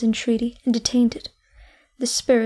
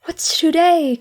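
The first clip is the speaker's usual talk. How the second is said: louder, higher call to the street; 5 semitones higher, 5 dB louder, slower than usual. A narrator's reading. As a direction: call out to the boy below. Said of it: "called Scrooge".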